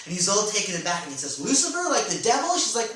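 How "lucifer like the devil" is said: surprised. Acted. Martin shocked at her name.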